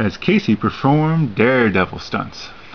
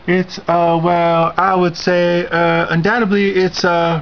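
higher, hemming and hawing; a guest groping for something polite; hesitant goose; 8 semitones higher than usual. Acted. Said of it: "replied Miss Goose".

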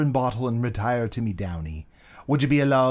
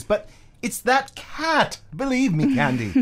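high, slow spooked insistence; 11 semitones higher, slower than usual. Acted. Spooked friend insisting to Candy it was the cat. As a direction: pitch jumps way up, drawn out with dread.

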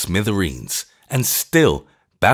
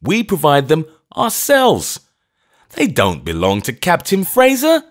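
laughing; 9 semitones higher, 5 dB louder than usual. A narrator's reading. Shorter laughing, explaining.

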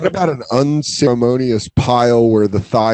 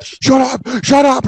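much higher, rising, flustered "shut up"; embarrassed shut up; 13 semitones higher, slower than usual. Retold or imitated. Roommate fending off teasing, high flustered protest. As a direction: squeal it out, defensive and embarrassed.

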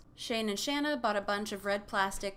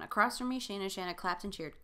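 ordinary talk

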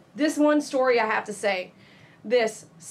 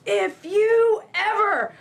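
high, loud, slow mock yell; retold yelling; 10 semitones higher, 5 dB louder, much slower than usual. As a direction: imitate the angry caller shrieking at you. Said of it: "she yelled at me".

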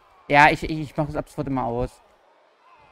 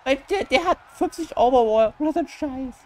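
indignant outburst; 9 semitones higher, 5 dB louder than usual. Real, not acted. Outraged at opponent's rating.

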